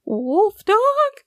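high, startled little dog gasp; a small dog stunned to see the wolf dog; disbelief. A narrator's reading. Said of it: "said Stretch in disbelief".

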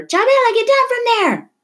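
put-on high, loud old man's shout; an alarmed grandfather calling out. A narrator's reading. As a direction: yell at her to get down. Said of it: "her grandfather shouted".

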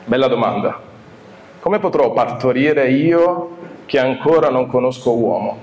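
ordinary talk

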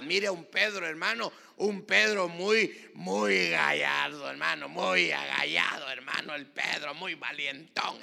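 loud preacher's commentary, stretching the adjectives; booming, emphatic sermon delivery; full-force preaching. Acted. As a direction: belt it out to the congregation, drawing out key words. Preaches on Peter's bravado.

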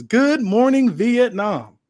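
high, loud DJ-style greeting; mock radio DJ. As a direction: boom it out like a radio host. Heel opens show with catchphrase.

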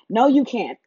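ordinary delivery

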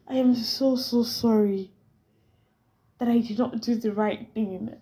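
hammed-up mock-dramatic apology; being dramatic. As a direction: overplay the sorry as a joke. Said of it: "I'm being dramatic".

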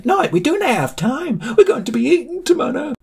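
high-pitched frantic wail; panicked. A narrator's reading. Sam cuts Ollie off about being eaten, panicked. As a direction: cut in with cartoon panic, voice way up.

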